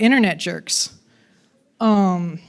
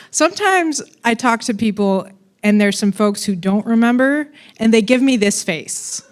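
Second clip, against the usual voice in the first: ordinary delivery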